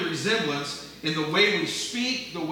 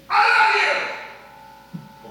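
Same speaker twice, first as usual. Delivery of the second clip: much higher and louder, very slow shout; shouted I love you; 8 dB louder, much slower than usual. Shown as a demonstration. Pastor showing the congregation a phrase, shouted slowly. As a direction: belt it out, dragging every word. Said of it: "I can say".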